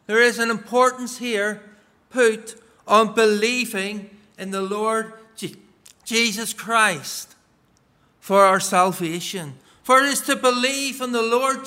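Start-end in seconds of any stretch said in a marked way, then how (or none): none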